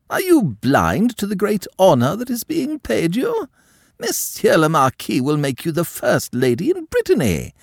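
high, loud, outraged aristocrat; indignant countess. A narrator's reading. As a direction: scold the girl with wounded pride. Madame berates foolish niece.

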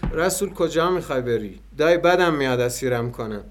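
ordinary delivery

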